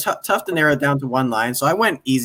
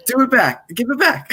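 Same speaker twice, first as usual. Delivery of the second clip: quoting; 8 semitones higher than usual. Retold or imitated. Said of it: "joining in on the quote".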